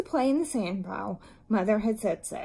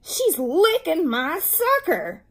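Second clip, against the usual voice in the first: high, loud, drawn-out tattling; slow, high-pitched wail of protest; loud complaint; 6 semitones higher, 5 dB louder, slower than usual. A narrator's reading. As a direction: complain loudly as an outraged child. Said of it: "interrupted Wayne in a loud voice".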